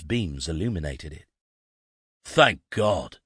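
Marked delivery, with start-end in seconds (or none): 2.2-3.2 louder burst of relief; relieved cry. Acted. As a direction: let out the relief after the long drive. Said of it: "Blake cried".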